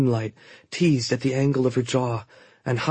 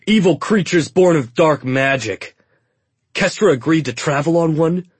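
scornful soldier; 5 semitones higher, 7 dB louder than usual. A narrator's reading. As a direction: sneer at the dark magic.

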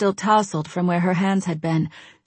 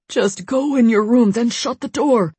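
pleading; 5 semitones higher, 3 dB louder than usual. Acted. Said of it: "his voice pleading".

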